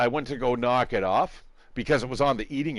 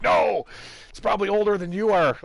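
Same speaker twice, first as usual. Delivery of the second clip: high, loud mock outcry; retold protest; 9 semitones higher, 4 dB louder than usual. Dad retelling his answer to his son, high mock-shouted protest. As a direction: protest it loudly, pitched right up. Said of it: "I'm like".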